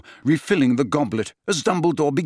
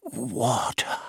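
croak; 6 dB quieter than usual. A narrator's reading. Dying old man croaking to Harry for water. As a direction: croak it weakly. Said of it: "croaked Dumbledore".

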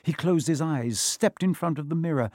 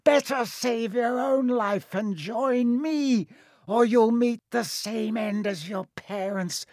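high, slow villain threat; menacing snarl; 9 semitones higher than usual. A narrator's reading. The face threatens Harry with his parents' fate, menacing. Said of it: "snarled the face".

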